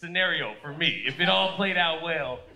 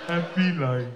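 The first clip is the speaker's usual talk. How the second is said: mock married guy; 4 semitones lower than usual. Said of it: "married guys who are like".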